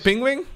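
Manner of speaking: "penguin" said the Dutch way, like "pingwin"; Dutch pronunciation. Shown as a demonstration. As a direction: say "penguin" with a short Dutch "ping" opening. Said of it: "in Dutch we say penguin".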